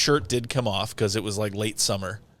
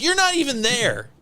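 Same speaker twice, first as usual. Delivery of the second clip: much higher mock wife voice; wife calling out her husband, much higher; imitating the wife; 11 semitones higher than usual. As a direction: pitch up as the accusing wife. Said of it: "she's like".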